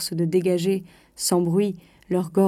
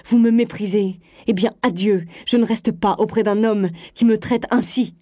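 loud, high, outraged repeated questions into accusations; theatrical outrage of a wronged wife; indignant yelling; 3 semitones higher, 5 dB louder than usual. A narrator's reading. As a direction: cry out mortally offended. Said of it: "elle s'indigna, hurlant".

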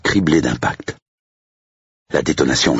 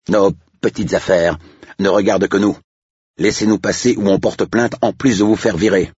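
higher-pitched, defiant threat; indignant estate agent; 8 semitones higher than usual. Acted. Estate agent threatens a complaint and dismissal, indignant. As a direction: snap back indignantly, pitch raised.

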